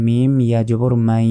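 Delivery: "মাই" drawn out long through the nose; ghunna. Shown as a demonstration. Teacher spelling the join, long nasal "মাই". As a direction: hold the end of "মাই" as a nasal glide. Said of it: "গুন্না হবে".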